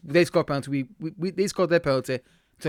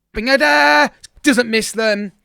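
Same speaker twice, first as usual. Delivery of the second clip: excited; 8 semitones higher, 8 dB louder, slower than usual. Real, not acted. Goal scored.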